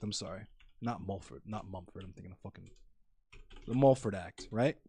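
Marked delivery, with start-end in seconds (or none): 0.0-2.7 muttered aside. Real, not acted. Muttered self-correction off to the side.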